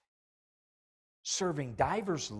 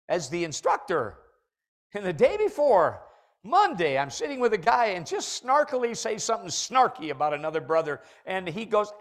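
ordinary talk, nothing marked

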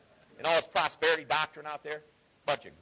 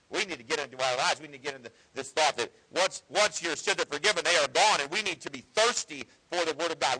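usual speaking voice

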